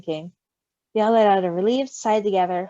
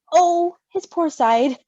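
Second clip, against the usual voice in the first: pained sympathy; 6 semitones higher, 5 dB louder, much slower than usual. Real, not acted. Sympathetic exclamation, slowed.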